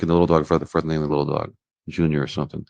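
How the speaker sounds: ordinary delivery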